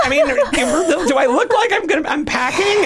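high-pitched comic disbelief through laughter; laughing incredulity. Retold or imitated. Teller to host about police suspecting a gun, laughing incredulity. Mocking the idea of being armed.

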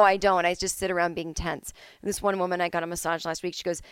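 ordinary delivery

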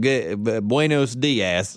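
George W. Bush Spanish. Shown as a demonstration. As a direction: stumble into buenos dias like a Texan president. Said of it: "it's that southern, that southern person".

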